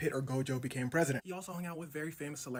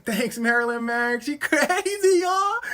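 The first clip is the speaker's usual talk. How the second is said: far higher, louder, nervous strained voice; flustered president; 9 semitones higher, 13 dB louder, slower than usual. Retold or imitated. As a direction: play off the embarrassment, rattled. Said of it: "He starts stuttering and stammering".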